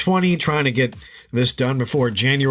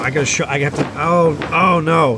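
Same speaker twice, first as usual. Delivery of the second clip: panicked. Acted. Skit: realizing he overslept.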